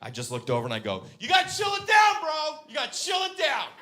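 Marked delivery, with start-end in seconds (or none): 1.2-3.7 very high, shouted mock calming; provoking yell. Driver needling an angry man, provoking high yell. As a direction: yell a call for calm to needle him.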